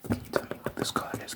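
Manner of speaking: hushed ASMR eye-test whisper. Pretend. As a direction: whisper the question quickly and very softly. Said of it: "I'm whispering because it's an A S M R video".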